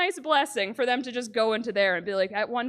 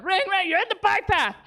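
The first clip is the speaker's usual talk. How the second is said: high, mock bell and yell; hammed-up bike path yell; Gord ringing again; 9 semitones higher than usual. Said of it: "everyone's just like".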